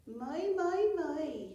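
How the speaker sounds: put-on high, loud old-woman voice; high cackling market-woman voice; Carty's character voice. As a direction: trill it out as a brassy old dame.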